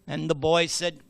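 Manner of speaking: ordinary delivery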